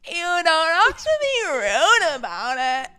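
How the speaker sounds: mocking a girl at a bar who complained about rudeness; mocking whine. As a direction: drag it out like a spoiled brat. Mocking an annoying girl, laughing.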